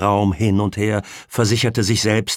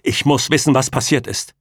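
raised, forceful insistence; hard; 6 semitones higher, 5 dB louder than usual. A narrator's reading. Pamela presses Martin for what happened, hard. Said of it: "sagte sie hart".

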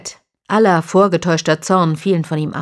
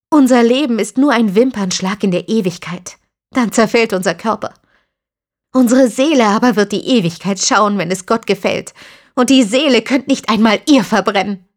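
put-on defiant young woman; 5 semitones higher, 5 dB louder than usual. Christina speaks of eternity.

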